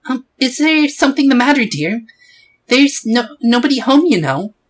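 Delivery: put-on high, fussy old lady voice; high-pitched elderly woman answering the door; old woman neighbour. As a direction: play a kindly nosy old neighbour.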